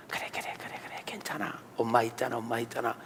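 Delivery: put-on soft, low whisper to a crying child; comforting mother. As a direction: hush and soothe your child. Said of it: "애를 꼭 안아주고". (우는 아이를 달래는 엄마, 속삭이듯)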